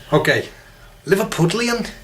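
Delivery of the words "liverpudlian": high-pitched Liverpool accent; attempted Scouse. Shown as a demonstration. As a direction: have a shaky go at Scouse. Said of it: "the old Scouse accent".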